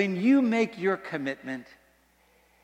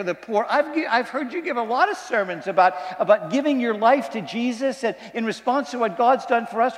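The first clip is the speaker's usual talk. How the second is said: higher, earnest plea of a grown daughter; pleading daughter; 7 semitones higher than usual. Retold or imitated. Daughter begs her preacher father to let her go, pleading. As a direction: beg her father to be proud of her, a bit hammed up.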